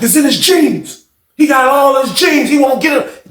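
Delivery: high, slow shouting about the jeans; excited shout. Retold or imitated. Retelling Smith shouting about jeans.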